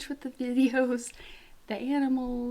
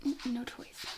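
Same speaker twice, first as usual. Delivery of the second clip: normal talking voice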